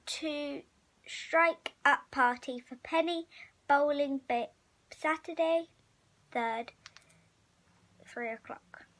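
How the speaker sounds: ordinary delivery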